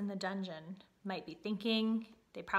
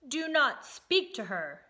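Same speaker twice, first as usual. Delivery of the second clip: slow, loud, commanding; thundering; 4 semitones higher, 6 dB louder, slower than usual. A narrator's reading. King forbidding the mouse to speak to princess, thundering. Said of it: "thundered the king".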